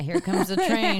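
put-on slow, playful feeding-the-baby voice; train spoon game. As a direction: make it a choo-choo train for baby. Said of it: "Chugga, chugga, choo choo, maybe?".

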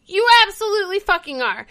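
high, emphatic put-down; mock blunt retort. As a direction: snap it back at him. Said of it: "And Cassidy's like".

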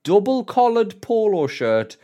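drawn-out, high and pleased with himself; mock-proud listing. Mocking his own teen outfit.

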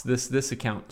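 normal talking voice

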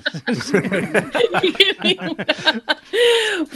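high, bursting laugh. Real, not acted. Friend's verbal slip amuses her.

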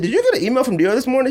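quick, high, excited asking; eager question. Retold or imitated. Husband asking his wife about the Dior email, quick and high.

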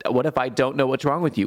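usual speaking voice

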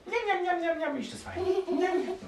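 very high, squeaky little gnome; munching gnome. Acted. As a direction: chant it greedily while smacking on sugar.

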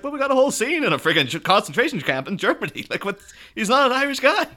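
high-pitched, mildly exasperated disbelief; incredulous protest. Retold or imitated. Podcaster objecting to an Irish Magneto, incredulous. Mock protest at accent choice.

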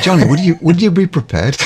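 failed American turning Irish; accidental Irish accent. Retold or imitated. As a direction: salesman line slipping into Irish. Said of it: "Sounds Irish".

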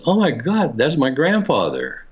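gasp-free, high-pitched mock amazement; retold astonishment. Retold girl's astonished recognition.

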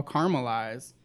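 caramelize said CAR-mul-ize; two-syllable caramel in caramelize. Shown as a demonstration. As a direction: say caramelize without the middle A. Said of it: "People are like".

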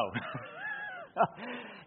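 high shrieking laugh breaking out; burst of laughter. Real, not acted. Laughs after own punchline.

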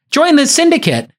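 high, hammed-up sales pitch voice; mock pitch. Host mocking a startup pitch to Shark Tank sharks, hammed-up and high. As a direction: pitch the syndicate like a cheesy promoter.

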